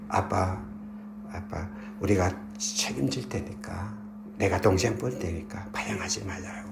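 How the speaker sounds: low, hushed plea to a father; retold plea, softly and earnestly voiced; soft, subdued appeal; quiet pleading. Older sons plead with father.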